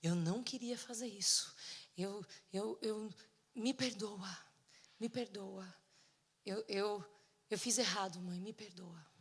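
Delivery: whispery, halting plea for forgiveness; acted weepy confession, falling to a whisper; tearful apology. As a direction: imitate a crying daughter, half whispering. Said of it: "chorar e falar assim pra mim".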